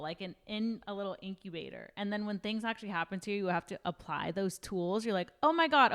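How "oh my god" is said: excited. Retold or imitated. Acted reaction to tools failing.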